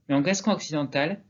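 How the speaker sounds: ordinary delivery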